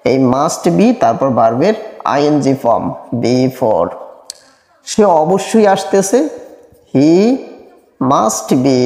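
ordinary talk

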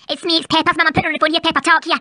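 deep, growling Scottish mum; gruff low mother voice. Acted.

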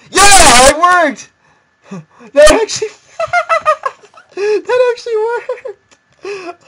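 high squealing laughter of triumph; laughing with joy. Real, not acted. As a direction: laugh giddily in disbelief. Joyful win, laughing.

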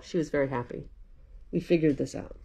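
usual speaking voice

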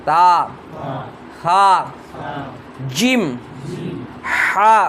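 Arabic letter names called out one by one, slowly, with pauses between; teacher chanting Arabic letters for students to echo; letter recitation drill. Shown as a demonstration. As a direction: recite the alphabet slowly for the class to repeat. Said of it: "আবার পড়ুন আলিফ".

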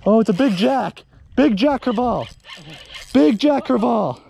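whooping with joy at the catch; excited. Real, not acted. Landing big jack crevalle.

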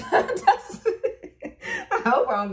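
cracking up, high squeal of laughter; real laughter, then talking through it; laughing. Real, not acted. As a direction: laugh helplessly into the words. Laughing at her own swearing.

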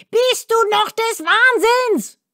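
hoher, empörter Zwergenschrei; schreiender Zwerg. A narrator's reading. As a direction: kreisch die Frage empört heraus. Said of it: "schrie der Zwerg". (empörter Zwergenschrei, hoch)

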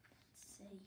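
hushed whisper. Acted. Reader inviting children to look, whispered. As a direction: lean in and whisper it.

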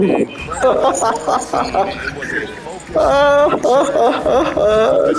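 long mocking laugh. Real, not acted. Gamer mocking rival beaten by Yao Ming, long laugh.

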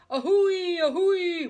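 high, loud two-note wail; wailing train whistle. A narrator's reading. Child imitates locomotive, wailing.

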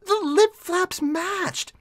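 high, drawn-out disbelief; amazed exclamation. Real, not acted. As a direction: marvel at it in a high, stretched voice. Amazed reaction to the clip.

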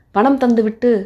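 usual speaking voice

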